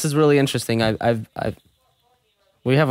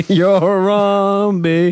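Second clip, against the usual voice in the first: laughing; 5 semitones higher, 4 dB louder, much slower than usual. Real, not acted. Laughing announcement of name.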